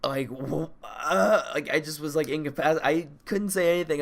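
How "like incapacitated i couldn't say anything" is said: ordinary talk, nothing marked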